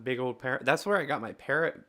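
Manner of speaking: ordinary talk, nothing marked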